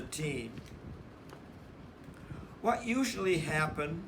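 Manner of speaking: put-on lively read-aloud for children; animated storybook narrator; story narration. A narrator's reading. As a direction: read it like a picture book to a class. Teacher reads picture book to class.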